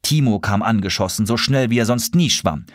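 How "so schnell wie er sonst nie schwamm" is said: ordinary delivery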